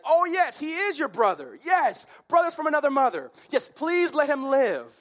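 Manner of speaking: very high, loud mock enthusiasm; hammed-up gushing and pleading; eager agreement. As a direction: agree effusively to save your skin. Captives play along, begging for life.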